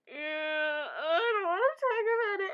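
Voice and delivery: high, loud mock whiny refusal; imitating an embarrassed friend; flustered friend. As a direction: whine it like he's squirming.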